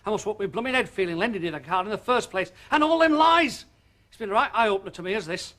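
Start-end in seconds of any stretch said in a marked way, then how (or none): 2.7-3.6 high, loud accusation; angry shout. Acted. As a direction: fling the word lies at her. Husband angry about her lies.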